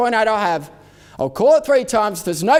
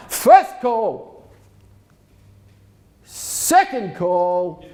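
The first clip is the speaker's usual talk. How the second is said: calling out; 3 dB louder, much slower than usual. Real, not acted. Auctioneer calling then drawing out.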